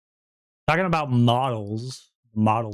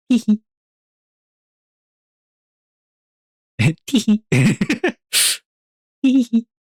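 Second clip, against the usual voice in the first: squeaky high tee-hee; high giggle; 10 semitones higher, 4 dB louder than usual. Retold or imitated. Mimicking giggle repeatedly.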